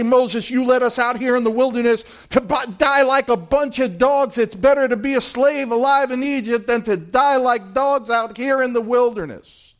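whiny grumble at Moses; mock whining complaint. Israelites grumble at Moses, mock whining. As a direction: complain like ungrateful followers. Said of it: "they murmur and complain".

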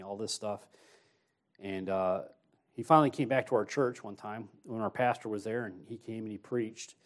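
ordinary delivery

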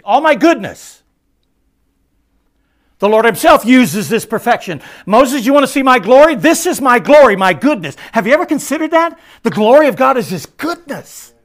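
high, loud, fervent preaching; full-force preaching. Real, not acted. Preacher to congregation, preaching at full force. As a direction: shout it to the back pews.

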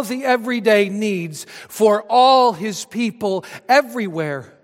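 high, loud, emphatic sermon build; forceful preaching. Real, not acted. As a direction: drive each phrase out to the whole congregation.

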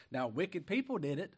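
ordinary delivery

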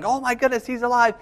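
high, thrilled cry of disbelief; excited retold yell. Rescue crew cheering the found survivor, high and excited. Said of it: "everyone's yelling".

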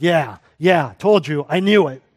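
loud, mocking I-told-you-so; gloating. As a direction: crow it like a smug bystander. Mimics gloating bystander at judgment.

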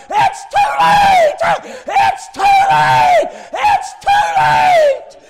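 very high, drawn-out scream at full volume; imitating a desperate deathbed scream, slowly. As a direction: scream it at the top of your lungs. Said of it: "her screaming at the top of".

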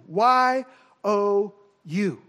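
separate, deliberate letters with pauses between; emphatic spelling. Acted. Preacher spelling out whom Jesus means, slow emphasis. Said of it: "the same kind of emphasis".

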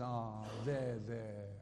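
soft, low, cooing comfort; gently mocking a coddling comforter; mock soothing. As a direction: pat him on the head with your voice. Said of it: "God doesn't come by to him and says".

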